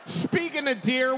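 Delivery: ordinary delivery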